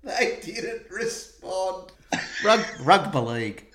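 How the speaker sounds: drawn out and incredulous; slow disbelief. Real, not acted. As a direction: stretch each word in disbelief. Repeating in disbelief.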